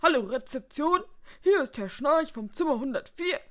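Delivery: high, loud complaining guest voice; indignant hotel guest. A narrator's reading. Guest ringing reception about an intruder, indignant.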